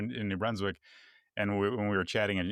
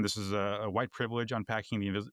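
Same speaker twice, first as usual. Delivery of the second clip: ordinary talk, nothing marked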